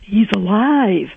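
slow, lightly smoky psychic line; lighter psychic voice. Retold or imitated. Quoting the psychic again on the radio, lightly smoky. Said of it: "she says".

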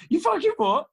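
high, loud mock outrage; outraged retort. Brother snapping back in outrage. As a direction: fire it back in disbelief.

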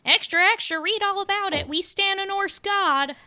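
put-on high, loud old-time paperboy call; newsboy cry. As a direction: shout it like a street newsboy. Newsies-style newsboy cry after Newsies mention.

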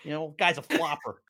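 put-on dismissive fan in a Philly accent; John Fox, Philly accent. Fan heckling James Harden, John Fox's Philly accent. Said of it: "that's my John Fox voice".

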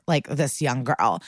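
normal talking voice

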